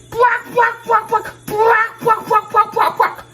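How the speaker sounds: very high rhythmic squawks; clucking chicken. Acted. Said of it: "said the chicken".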